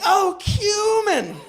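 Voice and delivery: "cumin" cried high with a long KYOO; over-corrected pronunciation. Shown as a demonstration. Man redoes his cry correctly, "cumin" as a drawn-out high KYOO-min. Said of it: "should have pronounced it correctly".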